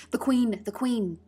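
high, loud alarm call. A narrator's reading. Gardener warning that the Queen is coming, calling out. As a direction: shout a warning across the garden. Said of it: "called out".

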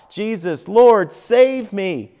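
desperate high loud cry for help; a sinking man's loud plea. A narrator's reading. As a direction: call out like a man going under. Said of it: "he cries out".

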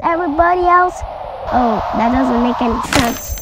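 ordinary talk

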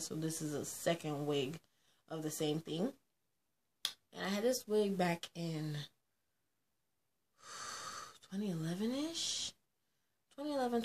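ordinary talk

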